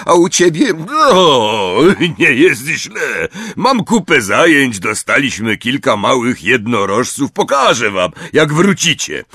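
a big friendly giant reminiscing; Hagrid's voice. A narrator's reading. Hagrid to Harry, fondly remembering. Hagrid talks about his work.

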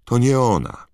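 put-on high, loud and firm, a girl putting her foot down; firm Hermiona. A narrator's reading. Hermiona firmly tells the ministry wizards, firm and nervous. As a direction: insist sharply, a little rattled. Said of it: "odezwała się Hermiona bardzo stanowczo".

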